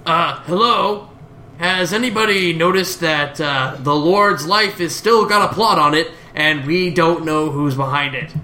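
a hint of a put-on voice, interjecting; lightly acted, raising an overlooked problem; Linkara. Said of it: "Linkara says".

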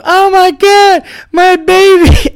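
shrieking high imitation of a panicked lady; mock scream. Lady whose little dog is attacked, hammed-up scream. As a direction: wail it loud, far up in pitch, hamming up the horror. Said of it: "Lady screamed".

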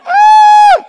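very high, loud squealing scream; shrill scream. Pretend. Pastor joking how he wishes he'd reacted, shrill scream. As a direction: scream like you're terrified. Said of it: "I'd be like".

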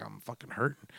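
very soft, low admission; hushed, pained aside; retold hurting. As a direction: say it small and under the breath. Retold admission of being hurt.

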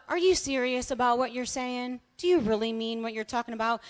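soft, understated question; mild asking. Retold or imitated. How the pastor's question began during worship, mildly asked. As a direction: ask it quietly, without force. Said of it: "it had turned from just a".

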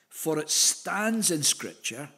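"stands" drawn out slowly with weight; stress on stands. Shown as a demonstration. Preacher reading the verse to the congregation, "stands" stressed and drawn out. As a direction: lean slowly on the word "stands". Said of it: "I love that emphasis on God's word standing".

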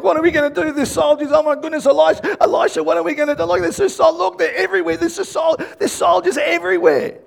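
high, loud, frantic babbling; hammed-up flap, tripping over the words; Gehazi in panic. Servant begs Elisha as soldiers surround them, acted panic. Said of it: "So I reckon Gehazi would have been like".

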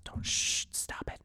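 put-on whispered shh to calm a baby; shushing whisper. Shown as a demonstration. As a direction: hush a crying baby in a whisper. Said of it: "shushing that motherfucker to death".

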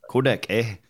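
put-on "Kodak, eh?" with Canadian accent; mock Canadian accent. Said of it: "unless they're from Canada".

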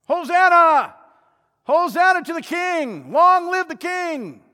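high, loud crowd acclamation; excited shouting. Acted. Crowd hailing Jesus on Palm Sunday, excited high shouting. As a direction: shout it out jubilantly, drawing out the last words. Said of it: "They're all excited".

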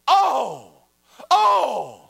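loud, high, falling mock-horror cries; horrified gasps. Woman reacting to the pastor's claim, mock horrified. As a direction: imitate a scandalized gasp, hammed up.

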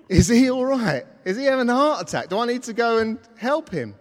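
high, loud, urgent questions; acted panic, voice jumping up; mock alarm. Man wondering if his mate needs help, loud mock alarm. Worry escalates over collapsed friend.

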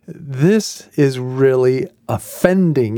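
ordinary talk, nothing marked

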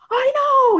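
high-pitched excited squeal; imitated giddy, very high outburst; retold freaking out. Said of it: "I'm freaking out".

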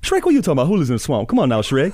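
high, loud, excitable talking donkey; pleading sidekick to his grumpy friend, in Donkey's voice; Donkey impression. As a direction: chatter at Shrek like an eager sidekick. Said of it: "Shrek and Donkey".

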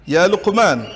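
calling out. Real, not acted. Teacher calls on a student.

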